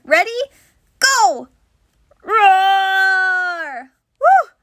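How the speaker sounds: big loud playful roar then a whoop; tiger roar. Pretend. Teacher leading children in a tiger roar. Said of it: "Let me hear your best tiger roar".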